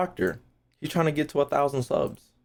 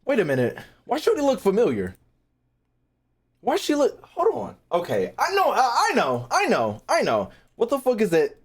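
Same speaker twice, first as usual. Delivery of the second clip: surprised; 8 semitones higher than usual. Real, not acted. Recognizing a familiar voice.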